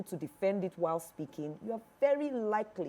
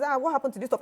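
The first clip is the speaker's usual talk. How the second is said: mocking critic; 7 semitones higher, 6 dB louder, much faster than usual. Voicing a critic of her outfit.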